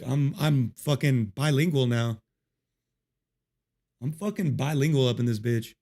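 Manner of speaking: ordinary talk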